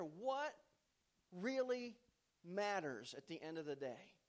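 rising preacher's voice, slow and weighty; preaching. Acted. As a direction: build it up like a pulpit sermon, then ask the question. Preaching, building the message.

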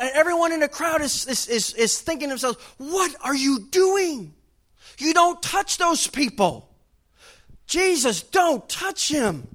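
high, loud alarmed crowd cry; crowd shouting. Retold or imitated. Preacher voicing the crowd warning Jesus off, high alarmed shout. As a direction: shout a horrified warning, slowly.

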